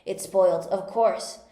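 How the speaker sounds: loud, forceful complaint; angry outburst. A narrator's reading. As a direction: hit it hard and loud, furious. Tweedledum raging over broken rattle.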